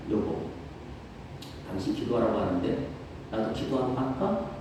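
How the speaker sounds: low, soft, humble question; soft humble husband to wife; gentle offer to wife. Retold or imitated. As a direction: ask her quietly and meekly. Husband gently asking his wife.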